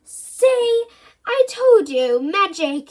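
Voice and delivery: high, loud triumphant crow; excited boast. Acted. Boy showing Mum his magic, triumphant crow. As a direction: show off the trick. Bertie boasting about vanished biscuit.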